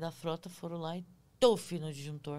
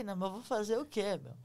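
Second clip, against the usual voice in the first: ordinary talk, nothing marked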